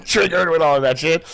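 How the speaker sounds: ordinary delivery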